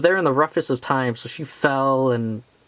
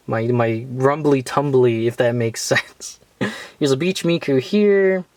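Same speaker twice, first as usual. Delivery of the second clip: ordinary talk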